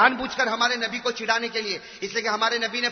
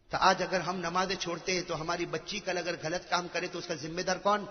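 ordinary talk

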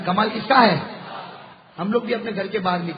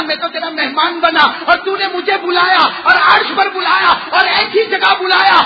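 high, driving sermon cry; impassioned preaching; 10 semitones higher, 7 dB louder than usual. Acted. Preacher pleading in the Prophet's words to Allah, high impassioned preaching. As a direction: push each line out insistently.

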